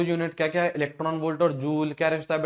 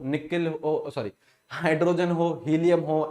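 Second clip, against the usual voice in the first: normal talking voice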